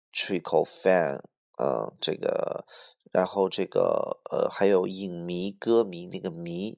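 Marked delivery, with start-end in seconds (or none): none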